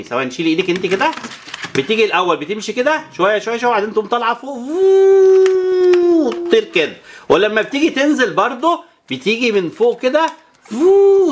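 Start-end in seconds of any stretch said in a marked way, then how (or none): 4.6-6.3 long high loud whoosh like a plane lifting off; whoosh sound. Shown as a demonstration. Mother to daughter showing how the plane takes off, loud whoosh. 10.7-11.3 high loud plane whoosh; a quick loud swoop of air; whoosh sound. Shown as a demonstration. Mother to daughter showing the plane swooping down, loud whoosh.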